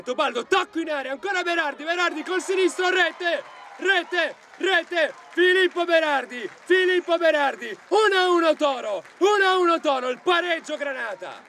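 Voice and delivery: screaming goal call, pitch way up; breathless italian goal commentary. Real, not acted. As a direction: yell the equaliser at full throttle. Equaliser goal call, shouted. (urlo del gol in telecronaca)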